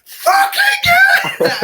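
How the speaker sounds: high, loud drawn-out scream; full-throated, stretched-out yell of joy; screamed celebration. Retold or imitated. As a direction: scream the place down in triumph. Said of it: "I screamed the fucking place down".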